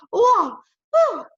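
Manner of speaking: high, loud stunned exclamations; acted winded astonishment; catching breath. A narrator's reading. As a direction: puff it out in amazement. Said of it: "tried to catch my breath".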